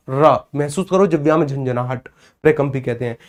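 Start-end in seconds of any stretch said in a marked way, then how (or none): 0.1-0.4 रा said with a rolled, vibrating tongue; trilled ra demo. Shown as a demonstration. Teacher demonstrates trilled रा to students. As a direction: let the tongue flutter on रा. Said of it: "जरा बोलकर देखो रा".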